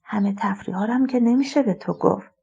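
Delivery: soft conspiratorial whisper. Acted. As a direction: lean in and whisper it so father can't hear. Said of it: "ملیحه آهسته گفت".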